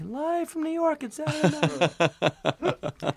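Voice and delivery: high, drawn-out mock SNL announcer call; show announcer. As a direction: ham up the big opening announcement, slow and airy. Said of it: "and then you hear, like".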